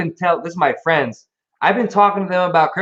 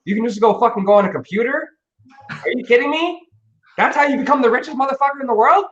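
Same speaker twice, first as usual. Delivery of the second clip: higher, excited, incredulous questions; retold disbelief; 6 semitones higher than usual. A developer recalling finding out coding could make him rich, amazed. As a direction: replay the shock of discovering easy riches. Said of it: "And I was like".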